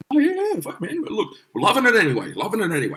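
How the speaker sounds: high, excitable crowd voice; mock enthusiastic punters. Crowd accepting another song, mock excited reassurance. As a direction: imitate fans happily reassuring the musician.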